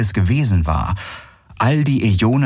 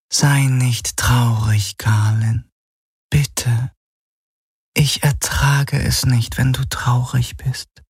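slow, higher, hushed female figure voice; tender shadow apparition, consoling; 4 semitones higher, slower than usual. Acted. Illusion of his beloved comforts Galen, tender and slow.

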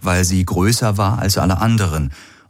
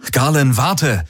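higher urgent call, volume contained; calling after someone; 8 semitones higher, slower than usual. A narrator's reading. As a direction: call him back, pitched up. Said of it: "rief Rick ihm nach".

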